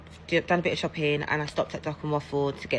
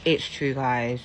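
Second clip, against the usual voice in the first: usual speaking voice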